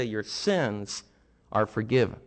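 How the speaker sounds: Jesus quietly forgiving a sick man; gentle forgiveness. Acted. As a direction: say it like a quiet blessing.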